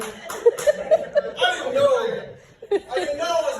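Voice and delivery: high, slow laughing "I didn't know"; laughing lament. Acted. Comic laments losing heat, high laughing wail. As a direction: stretch it out through a laugh.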